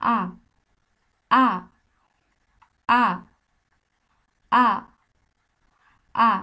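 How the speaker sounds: 「আ」 said again and again, slow and clear, for learners; repeated Spanish a. Shown as a demonstration. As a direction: drill 「আ」 with a pause between each. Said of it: "আমার সাথে সাথে বলেন".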